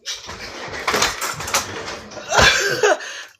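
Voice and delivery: breathy high-pitched laugh. Real, not acted. Laughs after civilized remark.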